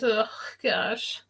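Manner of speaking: whispered ugh of disgust; real disgust, whispered under the breath. Real, not acted. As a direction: breathe it out, grossed out. Disgusted by customers' gross questions.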